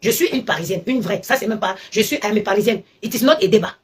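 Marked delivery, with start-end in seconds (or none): none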